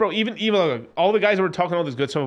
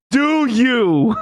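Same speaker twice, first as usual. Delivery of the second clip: loud, high, drawn-out question; big mocking challenge, raised pitch; emphatic challenge; 7 semitones higher, 6 dB louder than usual. Repeats the question, louder and drawn-out.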